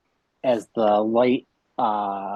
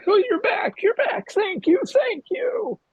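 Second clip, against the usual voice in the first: very high, loud broken sobbing; sobbing father; 4 dB louder than usual. Acted. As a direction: wail with relief over the revived son. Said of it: "starts sobbing and".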